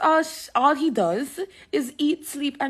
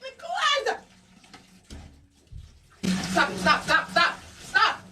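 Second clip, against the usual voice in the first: laughing yelp, then high stops; rising frantic stop, stop; restraining a pet; slower than usual. Real, not acted. Dog scratches during bath.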